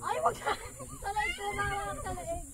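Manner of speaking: being tickled. Real, not acted.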